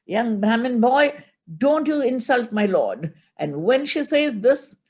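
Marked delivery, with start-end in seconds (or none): 0.1-3.1 much higher, louder indignant rebuke; angry Parvati. Acted. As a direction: scold the insolent boy fiercely. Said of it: "She gets so angry and she says".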